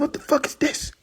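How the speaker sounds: high, softened disbelief; retold bewilderment. Man finding a strange box above him, bewildered.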